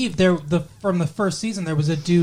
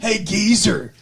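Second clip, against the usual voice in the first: higher-pitched hammed-up California surfer call; mock surfer dude accent; 4 semitones higher, 3 dB louder, slower than usual. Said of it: "Surfer dude accent".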